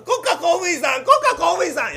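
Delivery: high, loud call across the room. Acted. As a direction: hail the official loudly. Calling the official.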